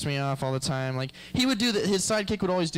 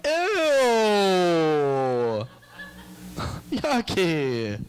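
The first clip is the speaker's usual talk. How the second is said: sidekick's disgust; 2 semitones higher, 4 dB louder, much slower than usual. Retold or imitated. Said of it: "his sidekick was like".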